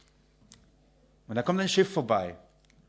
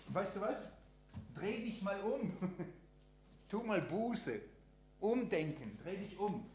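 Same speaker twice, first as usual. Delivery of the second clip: much softer, tender invitation; gentle Jesus voice; 5 semitones higher, 12 dB quieter than usual. Acted. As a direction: coax the sinner kindly to turn around.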